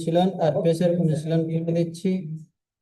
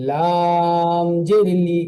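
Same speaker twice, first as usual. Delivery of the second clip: slowly drawn syllable; much slower than usual. Shown as a demonstration. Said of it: "টান না দিয়ে তাড়াতাড়ি পড়তে হয়".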